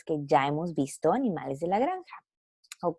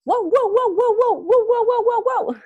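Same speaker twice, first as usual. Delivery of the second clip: high, loud rapid yapping; dog barking; 13 semitones higher, 7 dB louder than usual. Acted. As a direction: bark excitedly like a small dog. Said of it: "el sonido dice". (ladridos agudos y fuertes de perro)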